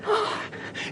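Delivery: sudden gasp of shock; sharp gasp. Acted. Lawyer gasps before telling the mother of a death. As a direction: catch the breath in shock.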